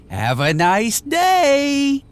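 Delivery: slow, high, gloating farewell; drawn-out mocking goodbye; with a laugh. A narrator's reading. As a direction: savor the trick as you say it. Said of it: "Rumpel said with a laugh".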